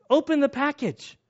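high, insistent order; urgent radio voice. Retold or imitated. Guy on radio telling soldiers to open it, high urgent. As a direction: bark it up high like an urgent command.

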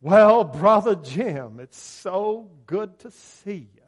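loud, drawn-out preacher voice, pitched high; pulpit voice. Retold or imitated. College friend greets Jim in grocery store, affected pulpit voice. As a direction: greet him in a put-on Billy Graham preacher accent. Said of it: "he started talking to me like this".

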